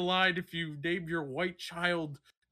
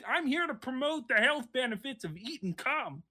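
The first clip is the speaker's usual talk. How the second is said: Kermit impression; 5 semitones higher than usual. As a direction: squeak it out like Kermit. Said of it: "that's Kermit".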